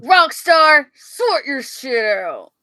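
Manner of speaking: telling off a company; mock scolding. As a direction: scold them, loud on the name. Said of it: "I'll let you say the same, same words".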